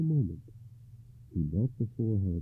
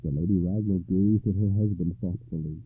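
usual speaking voice

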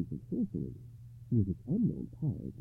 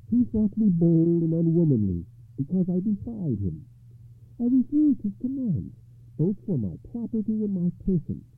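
higher, louder defiant lady; proud of having defied him; 11 semitones higher, 8 dB louder than usual. A narrator's reading. Ragnall tells Gawain why she was hated, proud and higher.

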